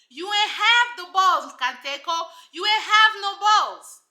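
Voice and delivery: loud, drawn-out telling-off; mock scolding. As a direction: scold hard, stretching out each accusation. Acting out a scolding of Kateko.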